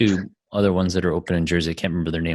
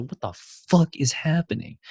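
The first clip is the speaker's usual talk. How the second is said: retold bewilderment; 9 semitones higher than usual. Worker reacting to a customer's crazy rant, retold bewilderment. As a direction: recall being baffled, pitch jumping up.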